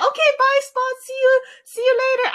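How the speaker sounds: high, quick pet-talk voice; acted cheery goodbye. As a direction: sing out a high farewell to a puppy. Child says goodbye to puppy.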